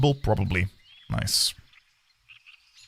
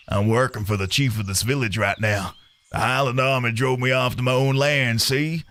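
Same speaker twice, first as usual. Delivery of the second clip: drawling Southern Texan cowboy; Southern accent; 4 semitones lower than usual. Acted. Tony the farmhand introduces himself in a Southern accent. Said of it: "what accent for Tony?".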